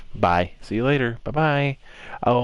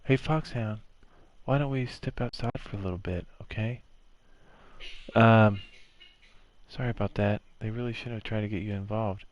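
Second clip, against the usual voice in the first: softer, gentle club president; soft Monika voice; 3 semitones lower, 4 dB quieter than usual. Acted. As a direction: coax him outside, softly.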